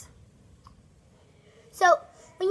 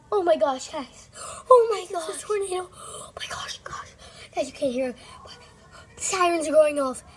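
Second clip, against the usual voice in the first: panicked bursts, loud then breathless hush; frightened, broken exclamations jumping in volume; real panic; 7 dB louder than usual. Real, not acted. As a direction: gasp it out as the sirens go off. Child hears tornado sirens.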